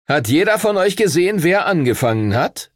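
high, gloating question; grinning question. A narrator's reading. Punched rival to the onlookers, grinning. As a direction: ask it through a grin. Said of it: "fragte er dann grinsend".

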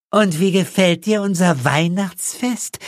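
high, sweet, innocent-sounding question; innocent smile. A narrator's reading. Mother asking the guest at dinner, smiling innocently. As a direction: ask it smiling and harmless. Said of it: "harmlos lächelnd".

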